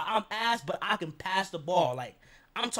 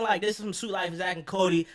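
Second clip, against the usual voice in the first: ordinary talk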